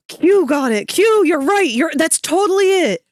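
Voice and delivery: high, loud excited squeal. Real, not acted. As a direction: squeal with delight. Shrieks she was right.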